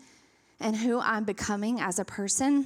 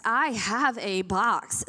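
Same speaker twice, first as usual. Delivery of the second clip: slow, word-by-word mock announcement; deliberate declaration; much slower than usual. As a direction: space out each word for comic emphasis. Acting out her eager inner answer.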